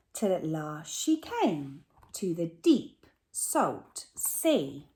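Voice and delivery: lively rhyming read-aloud; story narration. A narrator's reading. Teacher reading a rhyming picture book to her class. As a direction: read the verse with bounce for children.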